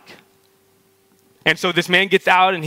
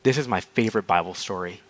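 low, soft, offhand remark; super casual; 8 semitones lower, 6 dB quieter, faster than usual. Retold or imitated. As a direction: imitate a friend casually leaning over to confide. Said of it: "like, super casual".

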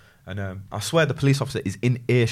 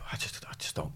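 breathless whisper of a puzzled colleague; whispered quote; 15 dB quieter than usual. Retold or imitated. Retelling his colleague's confusion, whispered. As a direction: hush it right down, half-ashamed.

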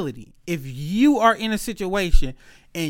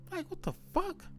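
incredulous; 12 semitones higher, 15 dB quieter than usual. Retold or imitated.

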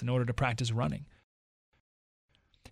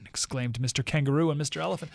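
ordinary talk, nothing marked

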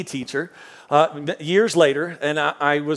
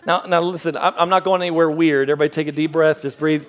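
ordinary delivery

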